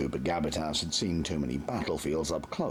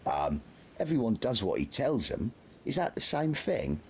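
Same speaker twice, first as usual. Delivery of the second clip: higher, hesitant voice; timid lad; 7 semitones higher than usual. A narrator's reading. Nobby answers Carter, nervous.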